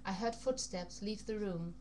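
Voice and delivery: plain reading voice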